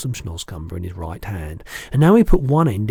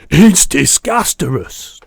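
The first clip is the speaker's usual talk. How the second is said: loud, higher, slow giant's cry; gurgled disgust; 5 semitones higher, 11 dB louder, slower than usual. A narrator's reading. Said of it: "the B F G gurgled".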